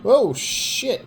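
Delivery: high, loud shocked outburst; surprised exclamation. Real, not acted. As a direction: blurt it out in shock. Sudden surprise at a move.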